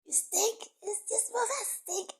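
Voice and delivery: high, loud cry about the smell; loud disgust. Retold or imitated. Reacting to the stink on the street, loud disgusted cry. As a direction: shout out how bad it stinks. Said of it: "and I'm like".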